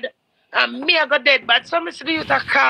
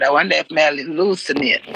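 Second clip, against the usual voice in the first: ordinary delivery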